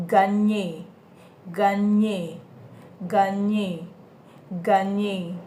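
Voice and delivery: "Gagner" with a palatal ny in the middle; French gn sound. Shown as a demonstration. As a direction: stress the ny of "gagner". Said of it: "Then we have ny".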